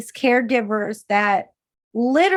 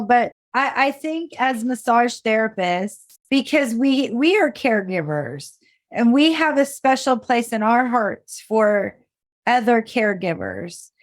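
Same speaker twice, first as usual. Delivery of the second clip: ordinary delivery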